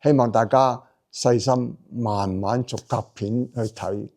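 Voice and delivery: ordinary talk, nothing marked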